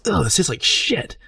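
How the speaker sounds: high, strained mock disgust. Third guy spitting out the apple, acted disgust. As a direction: recoil as if spitting out a foul bite. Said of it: "spits it out".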